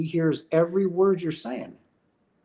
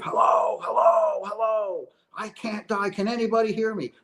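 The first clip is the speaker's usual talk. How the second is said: higher, frantic mock calls of hello; retold scream; 5 semitones higher than usual. A dead man calling to his wife, half-acted frantic yell. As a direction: call out to be heard, half-acted. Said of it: "he's screaming at his wife".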